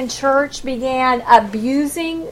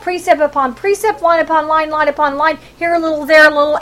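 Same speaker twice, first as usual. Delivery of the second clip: higher-pitched taunting recital of the verse; mocking quote; 5 semitones higher than usual. Northern tribes making fun of Isaiah, mocking. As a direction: throw the words out as mockery. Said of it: "making fun of Isaiah".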